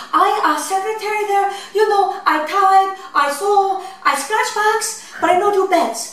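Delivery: high, sing-song put-on Japanese accent; high-pitched fake Japanese accent, female voice; mock Japanese accent. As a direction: prank job call in a fake Japanese accent. Prank caller voice continues.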